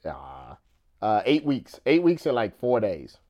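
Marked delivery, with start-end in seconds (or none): none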